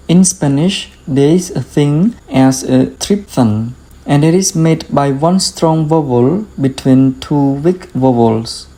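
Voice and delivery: ordinary delivery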